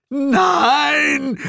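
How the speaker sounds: very high, loud drawn-out no; the hero screams as Glenda vanishes; desperate scream. Acted. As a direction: howl it in despair. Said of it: "brüllte ich".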